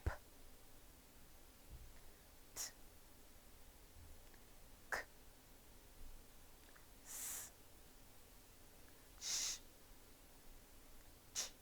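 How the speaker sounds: P, t, k, s, sh, ch as bare puffs of air, no voice; voiceless sounds demo. Shown as a demonstration. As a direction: breathe out each sound short, throat silent. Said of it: "repeat after me".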